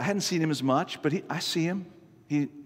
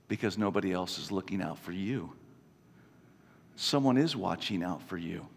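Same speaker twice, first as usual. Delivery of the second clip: softer, lower, reassuring; tender; 7 semitones lower, 5 dB quieter than usual. Retold or imitated. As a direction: speak it gently like a promise. Quoting Jesus tenderly.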